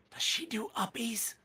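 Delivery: put-on high, hushed boy's whisper; soft whispered question; confiding whisper. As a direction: whisper it like a child asking in secret. Boy asks Tony privately.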